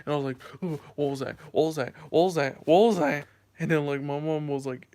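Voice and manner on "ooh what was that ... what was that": building panic. Retold or imitated. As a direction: panic rising with every repeat. Panicked after spider on shoulder.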